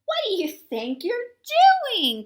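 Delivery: grumpy indignant outcry; cried out. Acted. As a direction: scold the new monster. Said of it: "cried Grouch".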